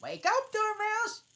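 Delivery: very high, loud wake-up call; crying out. Acted. Hare and Hatter shouting at the sleeping Dormouse, high and loud. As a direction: jolt him awake with a shout. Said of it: "both cried".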